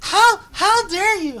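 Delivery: hammed-up indignant whine, high and loud; mock the pair's wounded outrage, slow. Mocking the filmed pair: high, loud mock outrage. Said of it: "And they're like".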